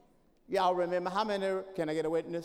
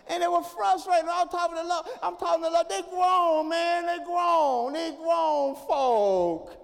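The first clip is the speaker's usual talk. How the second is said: fired-up preaching; 4 semitones higher, 6 dB louder than usual. Acted. Preaching at full force.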